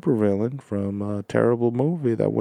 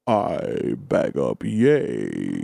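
Mitch McConnell impression; 11 semitones lower, 3 dB quieter, much slower than usual. Mitch McConnell mock rap.

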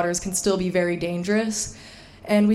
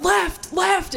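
high urgent cries of left; panicked calling out; 9 semitones higher than usual. Retold or imitated. To husband steering into the rapid, high panicked call. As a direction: call out in alarm, pitch jumping up. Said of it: "I'm like".

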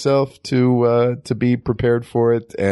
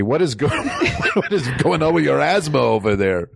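laughing; 4 semitones higher than usual. Acted. Improvised Russian cosmonaut bit.

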